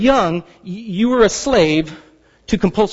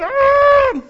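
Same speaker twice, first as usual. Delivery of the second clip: frustrated yell; 3 dB louder than usual. Retold or imitated. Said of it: "putting my hands in the air going".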